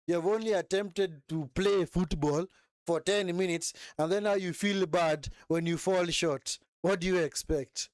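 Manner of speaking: ordinary talk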